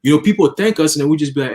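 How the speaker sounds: ordinary talk, nothing marked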